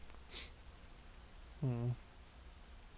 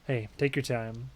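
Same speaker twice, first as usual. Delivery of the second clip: ordinary talk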